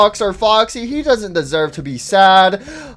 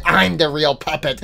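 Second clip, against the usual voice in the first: low, softer puppet voice; lower-voiced squabbling puppet; rival puppet; 5 semitones lower, 5 dB quieter than usual. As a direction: counter the claim in a low voice. Other puppet answers lower.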